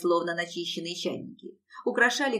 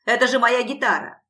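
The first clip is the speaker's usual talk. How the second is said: loud, higher-pitched accusing cry; shouting; 4 semitones higher, 8 dB louder than usual. A narrator's reading. Lanky musician claiming his guitar from Alisa, shouting. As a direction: cry out to claim what is yours. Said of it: "закричал".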